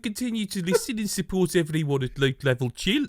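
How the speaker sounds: high, put-on Brummie accent; a hammed-up Birmingham read of a thank-you. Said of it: "now he's a Brummy".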